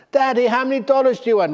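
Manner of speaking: put-on very high, loud and fast child voice; pestering child. Little son nags a third time, high fast child voice. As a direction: rattle it off like a whiny kid.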